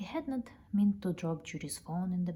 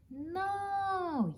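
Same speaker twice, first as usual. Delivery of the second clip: a much higher, drawn-out cry of alarm; alarmed yell; 12 semitones higher than usual. Acted. Man yelling as the sleigh slides off the roof, high alarm. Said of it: "yelled the man".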